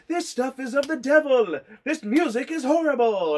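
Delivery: hammed-up sermon against the music; preachy scold. [name] as a preacher condemning rock music, hammed up. [name]'s movie preacher line.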